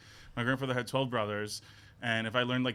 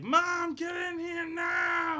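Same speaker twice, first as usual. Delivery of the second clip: put-on baby demanding; much slower than usual. Baby in the crib shouting for mom, mock demand. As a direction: bellow at mom like a bossy baby. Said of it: "I would be in the crib".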